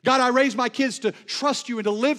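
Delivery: loud, high, impassioned preaching that slows to drag out each shaken line; preaching at full force. Acted. Preacher voicing the prophet's fear to the congregation, full-force preaching. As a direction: preach it like the storm is already here.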